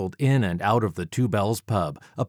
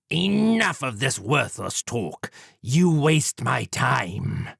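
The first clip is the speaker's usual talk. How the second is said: slow, sneering gentleman villain; sneering Jack; 4 semitones higher, slower than usual. Acted. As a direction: dismiss him with a sneer. Villain Jack voiced in story.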